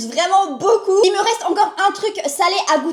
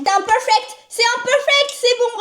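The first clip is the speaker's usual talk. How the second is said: high proud exclaim; excited; 8 semitones higher than usual. Real, not acted. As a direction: celebrate the clean seal. Proud jar opening.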